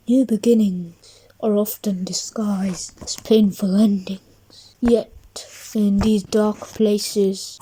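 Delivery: hushed wise words to a friend; soft whisper. Acted. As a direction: whisper it like a gentle secret. Wise hopeful lesson, whispered.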